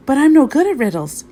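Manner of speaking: high, loud protest. A narrator's reading. Kitten objects to answering the riddle, high protest.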